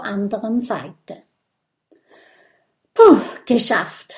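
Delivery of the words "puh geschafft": loud puff of relief; phew, relieved and done with it. A narrator's reading. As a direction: blow out the tension, relieved. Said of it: "sagt das Stachelschwein erleichtert".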